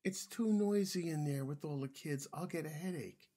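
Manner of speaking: high-pitched complaining dinosaur. A narrator's reading. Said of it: "in a quiet dinosaur voice".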